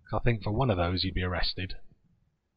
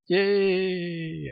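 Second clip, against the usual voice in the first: excited cheer; 10 semitones higher, 4 dB louder than usual. Real, not acted. Cheering the bill's withdrawal.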